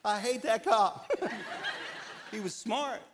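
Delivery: high, loud laughter through words; laughing. Real, not acted. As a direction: laugh while talking. Laughing at his story.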